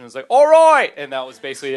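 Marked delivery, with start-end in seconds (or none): 0.3-0.9 loud, very high bad rock-star impression. Comic obeying a heckler's request, bad impression yell. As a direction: belt out a deliberately bad singer impression. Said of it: "terrible [name] impression".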